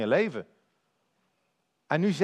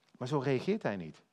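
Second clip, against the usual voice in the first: usual speaking voice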